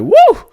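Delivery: very high loud whoop; mock woo. Mocking the boxer Roy Jones waking up, with a squealing woo. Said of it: "Roy was like".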